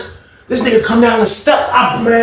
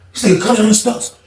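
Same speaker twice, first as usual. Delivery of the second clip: usual speaking voice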